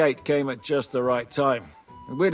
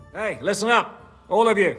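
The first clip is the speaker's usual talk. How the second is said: raised voice, pitched up to the group; calling for attention; 5 semitones higher than usual. Acted. Leader addressing the whole band, calling out. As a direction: call out to gather everyone round.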